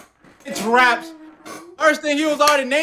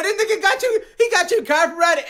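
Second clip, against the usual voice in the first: ordinary talk, nothing marked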